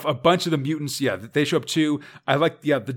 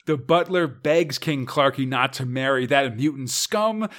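ordinary talk, nothing marked

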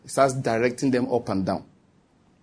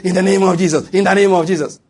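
loud, higher, repeated plea; alarmed prayer cry; 5 semitones higher, 6 dB louder than usual. Acted. Passenger reacting to smoke in the cabin, alarmed prayer cry.

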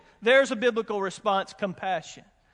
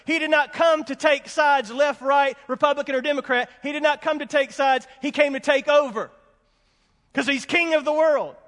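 louder and higher, preaching with fire; full-force preaching; 3 semitones higher, 3 dB louder than usual. Acted. Pastor rallying the congregation about flag and cross, fiery preaching. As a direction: drive the point home from the pulpit.

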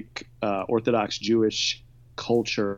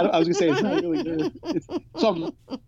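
higher and fast, laughing through the words. Real, not acted. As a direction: giggle while backpedaling. Laughing while hedging her review.

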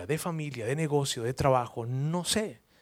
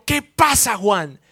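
irritated; 5 semitones higher, 10 dB louder, much slower than usual. Retold or imitated. Pedro turns.

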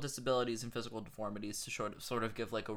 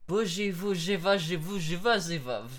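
sing-song buzzing mock German gibberish, raised pitch; Brando's fake-German nonsense; 5 semitones higher, 3 dB louder than usual. Actor pretending to speak German to a German, confident nonsense. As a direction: babble made-up German as if it were fluent. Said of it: "he just says nonsense to him".

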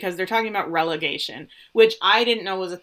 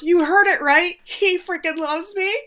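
imitating thrilled Ted; 11 semitones higher, 4 dB louder than usual. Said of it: "And Ted goes".

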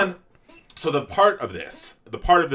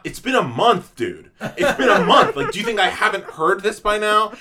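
laughing exasperation; 6 semitones higher, 5 dB louder than usual. Real, not acted. Exasperated rant at commenters.

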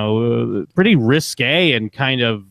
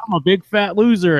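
higher-pitched mock stage punchline; hammed-up comedian catchphrase; 8 semitones higher, 4 dB louder than usual. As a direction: toss out a brash comic catchphrase. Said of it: "a female Larry the Cable Guy".

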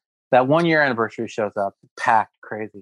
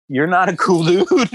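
slow, louder giggling taunt; laughing jab; 5 semitones higher, 4 dB louder, slower than usual. Retold or imitated. Barista gloating over a celebrity, laughing taunt. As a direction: savor each word through a laugh.

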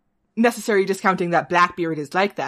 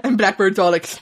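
ordinary talk, nothing marked

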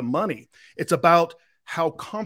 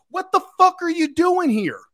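high, loud mock rebuke; imitating Gary V; 13 semitones higher, 5 dB louder than usual. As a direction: bark it at the crowd in a much higher voice. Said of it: "And he goes".

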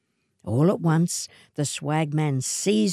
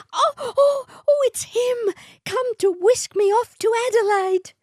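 very high, thrilled squeal; squealing with excitement; 4 dB louder than usual. A narrator's reading. Matilda squealing as her suitor arrives, very high. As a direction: gush like a giddy girl. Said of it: "Matilda let out a squeal".

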